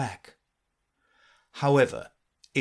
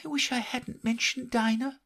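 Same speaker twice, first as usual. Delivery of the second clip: put-on higher girlish voice, wistful; melancholy; 13 semitones higher than usual. A narrator's reading. Alice alone regretting mentioning Dinah, melancholy. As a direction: say it sadly to yourself. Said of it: "in a melancholy tone".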